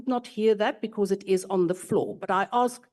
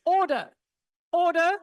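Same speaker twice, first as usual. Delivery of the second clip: calling for order; 7 semitones higher than usual. Real, not acted. Chair calls House to order.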